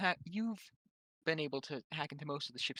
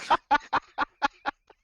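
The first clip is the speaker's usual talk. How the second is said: bursting into loud laughter at his own idea; loud cackling laugh, higher and louder; cracking up at the tear gas plan; real laugh; 9 semitones higher, 13 dB louder than usual. Real, not acted.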